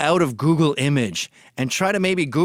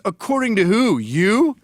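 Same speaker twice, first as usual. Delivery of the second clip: snide, higher mock voice of the critic; mocking retort; 4 semitones higher, 4 dB louder than usual. Imitating his critic's smug comeback. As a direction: sneer it back at him. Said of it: "And then he goes".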